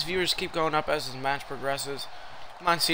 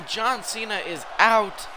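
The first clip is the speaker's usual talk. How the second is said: ordinary talk, nothing marked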